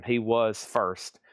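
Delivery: usual speaking voice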